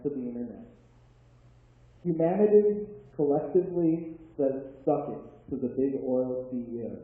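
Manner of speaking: loud, declaimed headlines with pauses between them; poem recitation. Acted. Poet reads future headlines to the audience, declaimed poem. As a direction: proclaim each line like breaking news.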